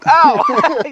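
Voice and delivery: high, loud startled ow; sharp yelp of pain. Real, not acted. Smacked by his friend, a loud high yelp.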